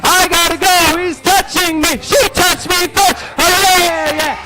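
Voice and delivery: high whining tattletale shout; whiny kid. Acted. Leading the kids' whiny chant.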